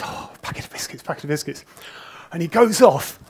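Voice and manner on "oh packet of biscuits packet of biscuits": a soft, breathy mock mumble of Stallman. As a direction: mutter it to yourself, imitating him. Imitating Stallman receiving biscuits.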